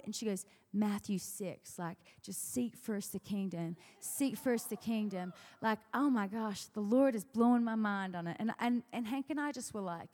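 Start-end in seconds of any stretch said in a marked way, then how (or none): 0.7-8.4 slow Louisiana drawl, then louder and amazed; drawn-out Louisiana accent, building in excitement. Retold or imitated. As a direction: imitate a Southern friend awed by her idea. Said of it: "in her beautiful Louisiana accent".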